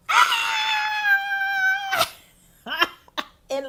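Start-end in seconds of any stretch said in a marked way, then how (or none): 0.1-2.1 high-pitched joyful squeal; long, high scream of sheer excitement; excited scream. Retold or imitated. Devotee hearing the guru on the phone, screaming. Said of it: "I started screaming and yelling".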